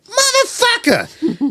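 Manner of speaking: drawn-out, high, loud curse; Joe Pesci outburst. Retold or imitated. Venting at the neighbor like Joe Pesci, furious curse. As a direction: explode like Pesci in Goodfellas. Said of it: "having a Joe Pesci, Goodfellas moment".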